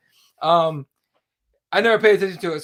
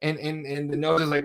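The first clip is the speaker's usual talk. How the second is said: ordinary talk, nothing marked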